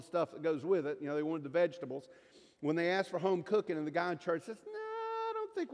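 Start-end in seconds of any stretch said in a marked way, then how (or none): 4.6-5.7 hedging official, mildly hammed up; reluctant refusal. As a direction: balk with a stretched-out no. Official's reluctant reply acted out.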